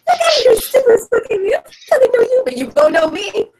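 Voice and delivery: high-pitched, hammed-up angry rant; mock screaming. Player as Karina confronting whoever super soaked her, mock screaming. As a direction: yell it in a high voice, loud and indignant. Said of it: "She's literally screaming out".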